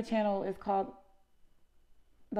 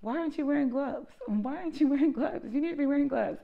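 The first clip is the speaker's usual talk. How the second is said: mocking commenters; 7 semitones higher than usual. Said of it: "the main comment that you will see is".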